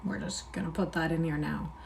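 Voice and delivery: quick breathy aside to himself; whispered setup note. Real, not acted. Aside to mic before reading.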